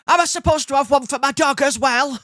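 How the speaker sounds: high, loud incredulous challenge; indignant retort. Retold or imitated. Passenger challenging the ticket inspector, indignant. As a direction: throw it back as an outraged question.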